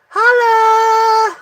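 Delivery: high, loud shout of the name. Real, not acted. Telling viewers his last name, a loud high shout. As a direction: belt it out like a call.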